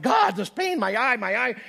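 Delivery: high, loud pained whine; mock complaint about the hurting eye; whining to God. Retelling his whining prayer to God in pain. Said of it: "and I'm like".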